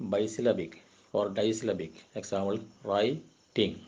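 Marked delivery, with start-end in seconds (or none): 2.9-3.7 "writing" split slowly into two syllables with a pause between; syllable breaks. Shown as a demonstration. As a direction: say "writing" in two separate units, write... ting. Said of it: "ഇടയ്ക്ക് pose".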